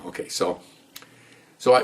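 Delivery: usual speaking voice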